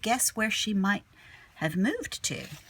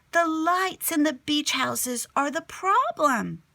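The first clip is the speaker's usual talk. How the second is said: put-on excited kid; 8 semitones higher, 4 dB louder than usual. Kid explains discovery.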